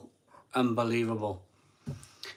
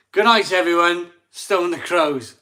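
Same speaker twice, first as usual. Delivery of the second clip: big booming goodbye, louder; loud sign-off; 5 semitones higher, 9 dB louder than usual. Real, not acted. Signing off the stream to viewers, loud and hearty. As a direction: shout the catchphrase to close the show.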